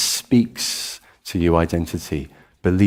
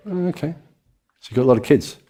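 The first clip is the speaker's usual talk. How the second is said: puzzled guest; 5 semitones higher, faster than usual. Acted. Party guest acting puzzled.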